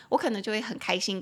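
ordinary talk

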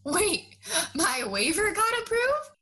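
high, rising "my waiver got approved?" as a stunned question; double-take, voice climbing on "approved"; sudden disbelief. Retold or imitated. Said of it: "And then I was like".

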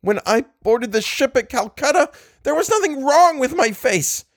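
shrill it out in panic. Acted. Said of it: "shrilled Ullman".

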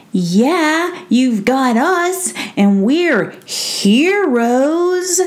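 a cheerful dog bragging; proud dog voice. Acted. Brisbane the dog boasts.

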